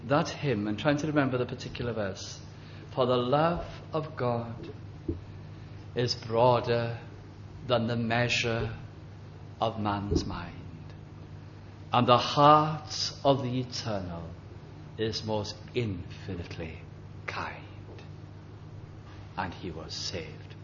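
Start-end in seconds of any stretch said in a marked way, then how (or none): 2.9-17.6 slow, measured reading of a hymn verse; reading a hymn to a dying old man, slow and soft; hymn recitation. Acted. 19.4-20.6 quiet awe. Real, not acted. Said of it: "Believe it?".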